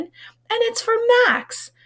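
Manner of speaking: high, slow, sing-song lady voice; delighted woman. Acted. Woman delighted at the ribbon, cooing high. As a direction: coo over the cat. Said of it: "said the woman".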